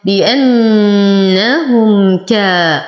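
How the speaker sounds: long nasal n, clean m, long ā in بأنهم كا; labial izhar. Shown as a demonstration. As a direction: chant بأنهم كا slowly, clear m with no hum before ك. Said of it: "فالحكم إظهار الشفوي". (ترتيل بأنهم كا بإظهار الميم ومد الألف)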